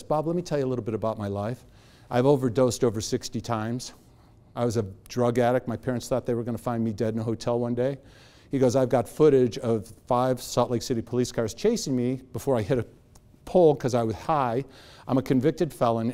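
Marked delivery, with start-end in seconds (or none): 0.0-8.0 soft, low, matter-of-fact confession; very calm. Retold or imitated. New acquaintance telling Bob his drug past, very calm. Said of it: "he was just very calm". 8.8-16.1 very calm, low, soft confession; James Hadlock. Retold or imitated. Man owning up to his past over lunch, very calm. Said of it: "he's going on like this".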